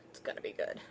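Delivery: hushed whisper to herself. Real, not acted. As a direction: breathe it out like a secret. Secretive aside.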